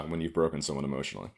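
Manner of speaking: ordinary talk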